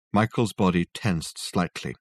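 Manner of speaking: ordinary delivery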